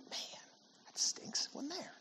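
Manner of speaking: put-on hushed, breathy letdown of a kid; whispered sigh of a let-down kid; whispered disappointment. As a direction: whisper it like a crushed child.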